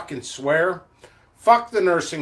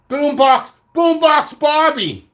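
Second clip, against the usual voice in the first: high, loud, stretched character call; Boombox Bobby; 8 semitones higher, 4 dB louder, slower than usual. Acted. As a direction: holler it like a cartoon hype man. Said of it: "I wrote this character".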